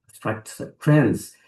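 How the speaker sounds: ordinary talk, nothing marked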